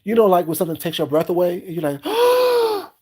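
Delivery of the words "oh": be breathtaken by the house; audible gasp. Retold or imitated. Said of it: "I had an audible gasp".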